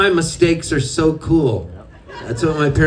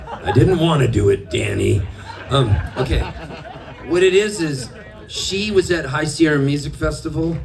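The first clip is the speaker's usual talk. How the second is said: ordinary delivery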